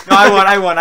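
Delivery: loud triumphant gloat. Real, not acted. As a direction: crow about winning the race. Gloating after beating friend.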